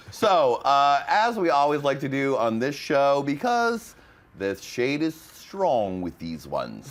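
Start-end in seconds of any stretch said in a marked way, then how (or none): 4.4-6.9 wise master voice. Pretend. Star Wars parody voice.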